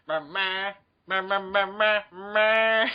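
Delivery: vocal music beats. Acted. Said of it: "do all the music beats, a cappella".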